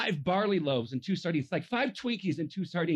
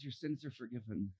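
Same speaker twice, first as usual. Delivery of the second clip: gentle Jesus; 10 semitones lower, 13 dB quieter than usual. Retold or imitated. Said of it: "He says".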